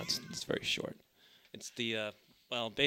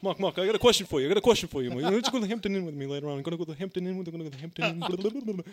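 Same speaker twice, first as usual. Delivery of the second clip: higher, fast mocking imitation of the host; mocking Derek's voice; 9 semitones higher, 6 dB louder, faster than usual. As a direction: mimic the pushy host, pitched up and rushed. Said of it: "this is what you do every time I work with you".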